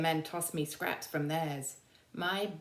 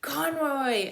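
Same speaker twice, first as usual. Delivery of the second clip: whining soldier; 10 semitones higher, 6 dB louder than usual. Acted. Soldier moaning.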